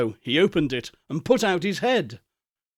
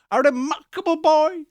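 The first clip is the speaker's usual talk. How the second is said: delighted; 11 semitones higher, 3 dB louder than usual. Acted. Scrooge delighted.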